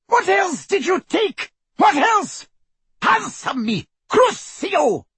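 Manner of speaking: high-pitched savage screech; furious interrogation. A narrator's reading. Torturer demanding answers from her victim, furious high screech. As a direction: screech each demand, building to the curse.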